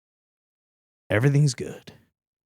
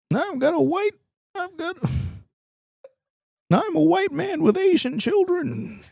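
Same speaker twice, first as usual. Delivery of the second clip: mock the dad in a high silly voice. Son mocking his dad in the family photo, high mock voice.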